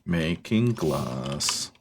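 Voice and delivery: low, very slow villain drawl; supervillain voice. Acted. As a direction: intone it like an evil mastermind. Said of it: "You said that like a, like a supervillain".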